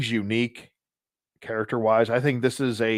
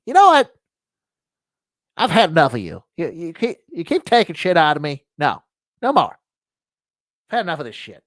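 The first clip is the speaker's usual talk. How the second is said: loud squeak dropping into fed-up rant; sudden voice change; 6 semitones higher, 8 dB louder than usual. Acted. Wallet rebelling against its owner, voice suddenly changing. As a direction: start in falsetto, then turn defiant. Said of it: "their voice changes all of a sudden".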